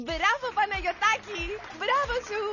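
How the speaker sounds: high, delighted bravo; gushing praise. Acted. Host congratulating the little contestant, gushing. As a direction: praise a small pupil effusively.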